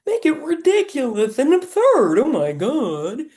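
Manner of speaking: high, loud, ridiculous cartoon voice; silly voice. Shown as a demonstration. Guide demonstrates a silly voice for the inner critic, cartoon squeak. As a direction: ham it up, absurd and squeaky. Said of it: "give it a silly voice".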